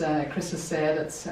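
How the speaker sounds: ordinary talk, nothing marked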